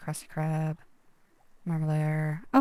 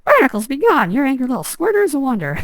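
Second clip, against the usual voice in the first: high, loud cheery cartoon voice; cartoon character voice; 8 semitones higher, 10 dB louder than usual. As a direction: belt it like a goofy cartoon hero. Reading a cartoon character's line.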